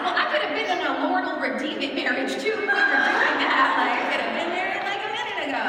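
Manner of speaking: loud, laughing protest, half joking; laughing mock outrage. Telling the congregation she could have married too, loud laughing protest. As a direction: throw it out loud while laughing at yourself.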